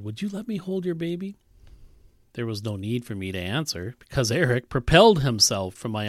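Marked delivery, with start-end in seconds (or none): 0.0-1.4 soft, pleading ask; a gentle, hopeful request; imploring. Retold or imitated. Old man asking the mother to hold her baby, softly pleading. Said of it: "eyes both asked and implored".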